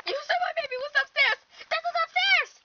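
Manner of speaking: very high, fast, frantic protest; loud high-pitched alarm; panicked mother. Acted. Mother panics over where baby is.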